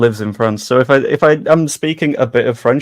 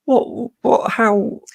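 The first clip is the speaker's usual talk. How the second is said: incredulous what; 12 semitones higher, much slower than usual. Real, not acted. Disbelief at the bad contract.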